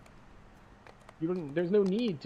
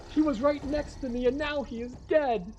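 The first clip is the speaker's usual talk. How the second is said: squeaky disbelief, much higher; shocked high voice; 13 semitones higher than usual. Retold or imitated. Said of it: "It's like".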